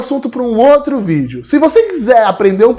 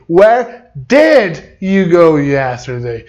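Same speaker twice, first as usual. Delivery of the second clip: slow, lower, deliberate question; slow correct question; 5 semitones lower, much slower than usual. Shown as a demonstration. Teacher modelling the corrected question slowly. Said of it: "Então na primeira".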